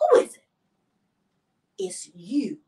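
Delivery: mock-horrified whisper; hammed-up hushed reveal; dramatic reveal. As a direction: whisper it like a shocking accusation. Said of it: "He's very dramatic".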